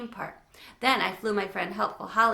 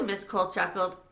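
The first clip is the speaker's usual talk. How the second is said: usual speaking voice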